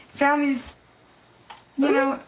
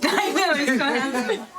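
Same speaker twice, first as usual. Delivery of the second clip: laughing. Real, not acted. Shared laugh over photo.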